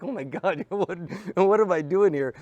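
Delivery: high, loud, half-laughing alarm; laughing panic. Retold or imitated. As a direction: laugh through mock panic. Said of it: "And I'm like".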